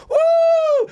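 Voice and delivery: very high, loud "woo"; crowd whoop. Retold or imitated. Imitating London fans cheering at his show, high whoop. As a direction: whoop loudly like a cheering audience. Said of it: "people go".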